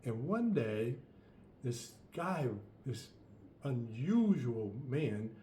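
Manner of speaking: ordinary delivery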